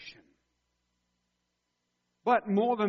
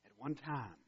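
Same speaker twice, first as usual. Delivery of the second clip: quiet; 4 semitones lower, 6 dB quieter than usual. Shown as a demonstration. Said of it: "He's describing your past".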